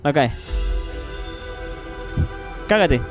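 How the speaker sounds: ordinary delivery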